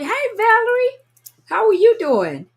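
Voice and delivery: light up at seeing a friend's name; squealed happy hello; excited greeting. Real, not acted. Greeting a familiar viewer.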